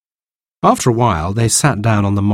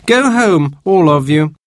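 much higher, louder command; bossy order; 8 semitones higher, 4 dB louder than usual. Acted. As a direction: shoo them away. Baby sends siblings away.

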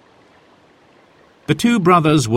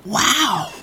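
put-on high, wide-eyed child's wow; amazed; 12 semitones higher than usual. A narrator's reading. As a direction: gasp it out in wonder. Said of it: "said Jack".

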